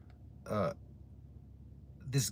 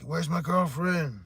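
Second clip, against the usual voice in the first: mock yell; 7 semitones higher, 5 dB louder, slower than usual. Intruder demanding his girlfriend.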